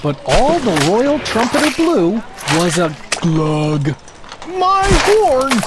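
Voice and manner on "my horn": high, louder cry of dismay; gulping dismay. Acted. Trumpeter finds his horn blocked, a gulping cry. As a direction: choke out the shock. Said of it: "he gulped".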